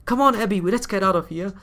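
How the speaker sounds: put-on high urgent girl's voice; young heroine voice. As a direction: call out as the heroine.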